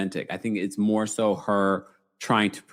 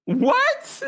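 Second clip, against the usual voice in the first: surprised. Real, not acted. Shocked by a misread comment.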